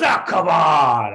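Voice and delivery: loud, slow, drawn-out protest; mock heckler yelling. Heckler objecting to the comedian, drawn-out mock yell. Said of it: "he just keeps yelling".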